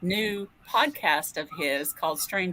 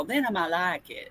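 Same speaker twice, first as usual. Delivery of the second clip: Southern belle; 3 dB quieter than usual. Acted. Author joking about recording her stories, Southern belle accent. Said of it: "read it like a Southern Belle".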